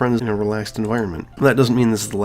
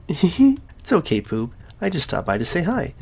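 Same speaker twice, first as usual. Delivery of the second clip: put-on higher, slow, sweet girl voice; Sayori voice; 6 semitones higher, slower than usual. Sayori reassuring her friend, high and gentle. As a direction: lift it into a gentle, girlish tone.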